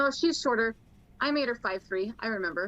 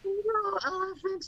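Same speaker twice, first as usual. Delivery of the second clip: cooing to an elephant; 9 semitones higher than usual. Acted. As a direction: greet the animal adoringly. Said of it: "she's going to talk to the elephant".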